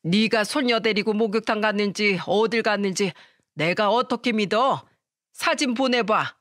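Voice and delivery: usual speaking voice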